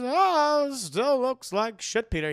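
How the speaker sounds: put-on high, slow old aunt voice; Aunt May voice. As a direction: squeal it out like a doting old aunt. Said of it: "That's my Aunt May voice".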